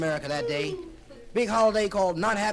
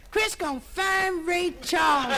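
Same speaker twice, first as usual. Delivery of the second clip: much higher, slow woozy voice; tipsy Isabel; 10 semitones higher, 4 dB louder, slower than usual. Acted. Tipsy Isabel calling out to Chris, high and slow. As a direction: drawl it out like she's had a few. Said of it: "Isabel saying".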